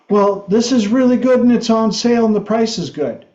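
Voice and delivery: put-on kid's sales pitch. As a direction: rattle off the pitch like a keen salesboy. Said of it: "did you give her".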